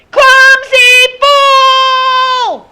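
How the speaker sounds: put-on very high, very slow child's scream; drawn-out scream. A narrator's reading. Boy showing the man what yelling is, deafening scream. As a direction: scream it at the top of your lungs, stretching each word. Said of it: "he screamed".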